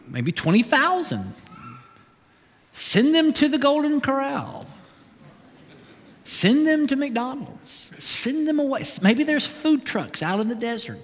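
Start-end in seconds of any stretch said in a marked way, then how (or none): 2.7-11.0 lightly voiced, softer, higher disciples' suggestions; half-joking voice of the disciples; mock disciples. Preacher voicing hungry disciples to Jesus, lightly mocking.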